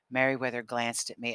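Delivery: ordinary delivery, nothing marked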